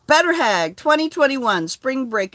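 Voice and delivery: high, loud announcement; excited announcement. Real, not acted. Announcing the Better Hag trip to friends, high and loud. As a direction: proclaim the title with pride.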